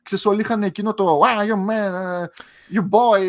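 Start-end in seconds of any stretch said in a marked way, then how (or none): 1.2-3.3 mock old-movie American accent, drawn out; old-time American accent. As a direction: imitate vintage American film talk with stretched vowels. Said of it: "πώς άλλαξε η προφορά τους". (μίμηση παλιάς αμερικάνικης προφοράς)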